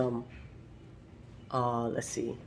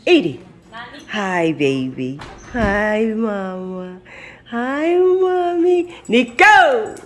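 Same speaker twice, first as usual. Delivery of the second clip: high, slow cooing at a pet; 5 semitones higher, 4 dB louder, much slower than usual. Real, not acted. Greeting the dogs lovingly.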